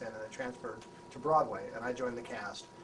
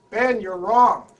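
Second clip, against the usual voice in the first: loud higher call of you're wrong; mock challenge; 9 semitones higher, 19 dB louder, much slower than usual. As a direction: stand up and call it out. Imagined challenge to star.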